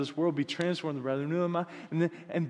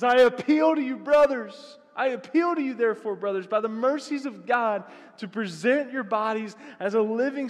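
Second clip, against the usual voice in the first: much higher and louder scripture cry; fervent preaching; 9 semitones higher, 5 dB louder than usual. Real, not acted. Pastor preaching Paul's plea to the church, full force. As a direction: preach the appeal with full fire.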